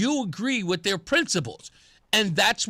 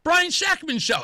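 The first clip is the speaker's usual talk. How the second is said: pitched-up, half-teasing objection; retold "dude" protest, lightly raised; mock protest; 9 semitones higher than usual. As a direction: call him out playfully. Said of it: "I'm like".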